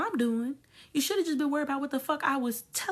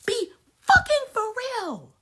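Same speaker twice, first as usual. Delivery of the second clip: much higher and louder yell; shouted repeat; 9 semitones higher, 5 dB louder than usual. Real, not acted. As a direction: yell it the second time. Repeated rant line.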